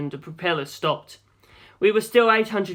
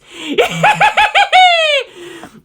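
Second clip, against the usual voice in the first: shrieking high cackle, very loud; shrill monster laugh; 10 dB louder than usual. A narrator's reading. Fanged monster cackling at the doomed pilot, shrill. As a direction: cackle like a gleeful little demon. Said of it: "The laugh was so shrill".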